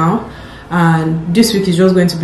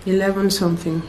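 really tired; 10 dB quieter than usual. Real, not acted. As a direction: speak softly, worn out at the end of the day. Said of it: "I'm really tired".

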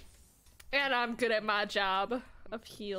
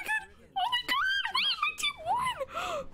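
excited shout; slower than usual. Real, not acted. Surprise comeback win.

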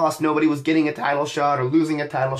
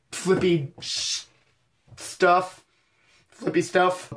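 self-censored swear; 3 semitones higher, much slower than usual. Real, not acted. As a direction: cut off the curse, then settle on stuff. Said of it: "self-censoring a swear".

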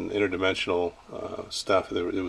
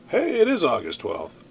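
higher, brighter triumphant read-out; pleased announcement; 8 semitones higher than usual. Real, not acted. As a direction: announce it like you were right. Reading date off phone.

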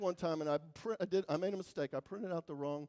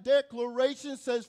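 ordinary talk

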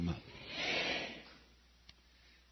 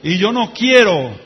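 slow, measured prayer swelling louder toward the end; led prayer; 4 semitones higher, 6 dB louder than usual. Acted. Preacher leads congregation in repeat-after-me prayer, building. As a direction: pray line by line for them to repeat, building up.

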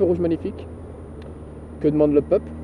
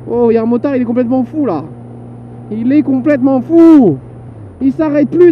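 excited commentary; 6 semitones higher, 5 dB louder than usual. Real, not acted. Excited running commentary on motorcyclist.

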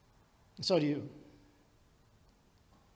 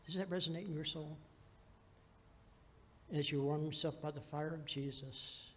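normal talking voice

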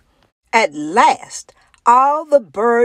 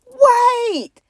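much higher, loud call; urgent call; 4 dB louder than usual. Acted. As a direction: stop him before he leaves. Flamingo has an idea.

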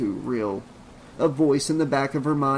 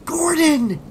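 loud, high-pitched urgent call; strained shout; 7 dB louder than usual. A narrator's reading. Trish calls the vanished dog once more, high strained shout. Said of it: "shouted in a strained whisper".

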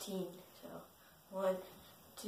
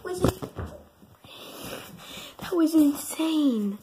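high loud amazed outburst; excited; 7 semitones higher, 8 dB louder, slower than usual. Real, not acted. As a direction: burst out amazed. Excited after the run.

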